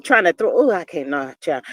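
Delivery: ordinary talk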